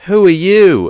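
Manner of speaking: high, loud quoted demand; acting out a challenger. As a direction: call it out as some guy challenging a stranger. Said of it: "and then some guy goes".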